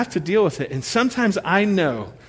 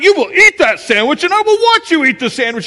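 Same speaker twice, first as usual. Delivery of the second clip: loud, high commanding dad; screaming battle; 10 semitones higher, 6 dB louder than usual. Retold or imitated. Dad commanding toddler to eat, loud high strained order. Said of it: "screaming battle".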